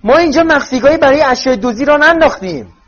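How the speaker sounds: high, very loud yell; screaming at full volume. A narrator's reading. Mrs Weasley yelling about stolen goods in the house, screaming. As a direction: shout it at the top of your voice.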